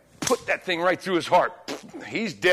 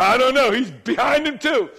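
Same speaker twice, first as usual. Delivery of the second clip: higher, raised reporting voice; acted calling down; 4 semitones higher, 3 dB louder than usual. Preacher acts watchman answering the king, calling down. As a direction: play a baffled lookout calling his report down.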